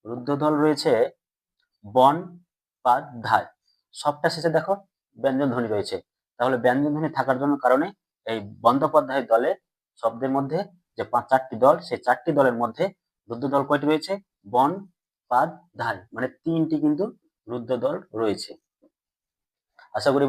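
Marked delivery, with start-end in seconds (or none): 1.9-3.5 বন, পাত, ধায় with clear gaps; syllable by syllable. Shown as a demonstration. Teacher splits word into syllables. 14.5-16.0 বন, পাত, ধায় as separate beats; a short pause between বন, পাত, ধায়; syllable by syllable. Shown as a demonstration. Teacher splits word into syllables.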